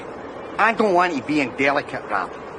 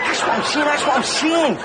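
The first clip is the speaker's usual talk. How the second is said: eager insistence; 7 semitones higher than usual. Acted.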